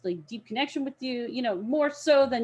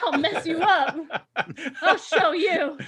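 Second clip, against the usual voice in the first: louder playful threat with laughter; cutesy girl acting tough; mock tough threat; 6 semitones higher, 5 dB louder, slower than usual. Cute cosplay threatening.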